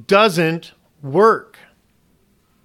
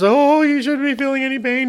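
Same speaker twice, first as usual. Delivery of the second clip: mock fussing wife; 7 semitones higher than usual. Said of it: "And my wife is".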